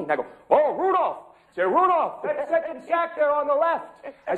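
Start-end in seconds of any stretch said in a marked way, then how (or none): 0.5-1.2 high, loud mock Santa call; Santa yelling orders. Santa yelling orders to the reindeer, high and loud. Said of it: "I yell orders to the elves". 1.6-3.9 high, loud mock Santa call; Santa yelling orders. Santa yelling orders to the reindeer, high and loud. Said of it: "I yell orders to the elves".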